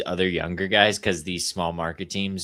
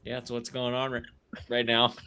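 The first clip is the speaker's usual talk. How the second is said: ordinary talk, nothing marked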